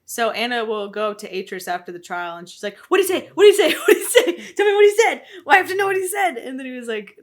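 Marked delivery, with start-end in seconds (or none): 2.9-6.3 high, loud, laughing frantic questioning; amped-up retelling. Retold or imitated. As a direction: demand it over and over, pitch shooting up, half laughing. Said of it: "she's so fucking amped about it".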